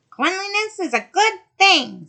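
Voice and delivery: loom over her as the sea witch. Acted. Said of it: "said she".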